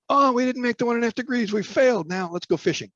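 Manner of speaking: high, whiny mock complaint giving up; lampooning a quitter who wants to go fishing; mock defeatist whine. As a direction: pitch it high, pretend to throw in the towel. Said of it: "We do not have the opportunity to say".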